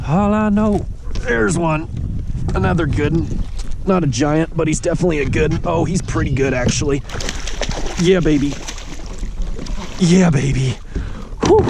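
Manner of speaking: high and eager while reeling; excited. Real, not acted. Angler pumped up as he lands a good bass, excited. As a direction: cheer the fish in. Landing a fish.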